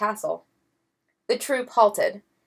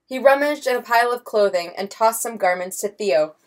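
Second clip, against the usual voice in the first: usual speaking voice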